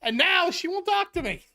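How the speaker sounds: rant, defending the indefensible; George ranting. Retold or imitated. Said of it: "and George goes".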